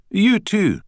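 high, loud Scottish farewell; Scottish accent. Acted. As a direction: call it out like a cheery Scot. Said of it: "in a strong Scottish accent".

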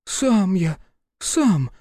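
put-on high, eager child voice; eager boy. A narrator's reading. Boy Гришка eagerly agrees in a high voice. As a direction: chirp it like a nodding kid. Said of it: "закивал Гришка".

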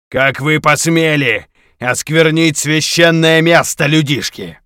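booming, slow, high otherworldly voice; demonic voice. Acted. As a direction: thunder it like a wrathful spirit. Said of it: "Демонический голос. Потусторонний".

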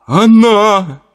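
high sobbing cry; through sobs. Acted. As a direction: force it out between sobs. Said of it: "Сквозь всхлипы пытался что-то сказать".

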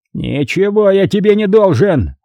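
high-pitched outburst, little added volume; exploding cardinal. A narrator's reading. Cardinal refusing his pleading captive, an angry high-pitched outburst. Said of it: "взорвался он".

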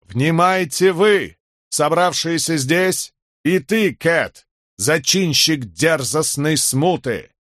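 loud, high, slow decree; stern haughty herald. A narrator's reading. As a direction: read it down to rebels. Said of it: "Тон его был суров и высокомерен". (суровый надменный глашатай)

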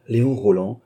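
ordinary delivery, nothing marked

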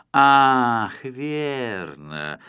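mock sudden recognition; exclaiming. A narrator's reading. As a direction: burst out remembering, then settle. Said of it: "воскликнул Спруц".

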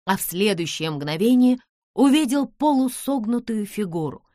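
ordinary delivery, nothing marked

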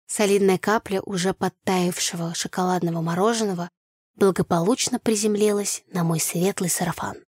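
plain reading voice

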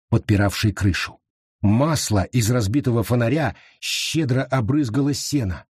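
plain reading voice

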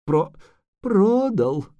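high, halting moan; pitiful wailing confession. A narrator's reading. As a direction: stammer it out as a groan. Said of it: "простонал".

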